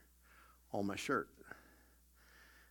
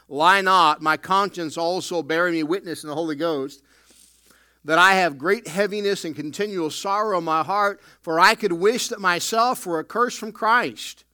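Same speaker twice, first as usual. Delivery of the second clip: loud, raised-pitch pulpit preaching; full-force preaching; 4 semitones higher, 9 dB louder than usual. Acted. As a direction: thunder it from the pulpit with urgency.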